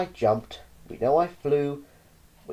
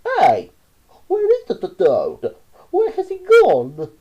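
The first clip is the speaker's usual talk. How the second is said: loud, slow and rising to high; baffled mob shouting; cried out; 10 semitones higher, 9 dB louder, slower than usual. A narrator's reading. Frenchmen with knives shout for the vanished toad, loud. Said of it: "the Frenchmen with their knives cried".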